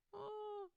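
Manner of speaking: high, soft coo; soft, high sigh of fondness; touched aww. Real, not acted. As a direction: let out a small melting oh. Teller moved by the scene.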